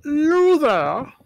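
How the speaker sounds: high, loud hail; calling out. Acted. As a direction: holler to someone out of sight. Calling to creatures in the bushes.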